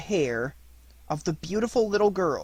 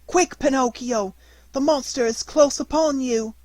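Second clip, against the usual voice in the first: much higher, urgent pleading cry; bleating cry; 9 semitones higher, 5 dB louder than usual. Acted. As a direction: bleat it out in panic. Goat urging.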